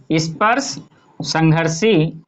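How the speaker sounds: स्पर्श, pause, then a slow संघर्षी; word by word. Shown as a demonstration. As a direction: break the term apart for students, drawing out संघर्षी. Teacher repeats term in parts.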